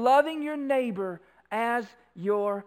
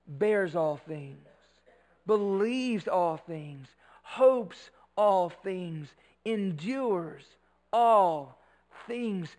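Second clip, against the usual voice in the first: slow, measured reading with long pauses; solemn scripture reading; slower than usual. Real, not acted. Preacher reading the love chapter to the congregation, slow and solemn. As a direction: let each phrase stand alone, slowly.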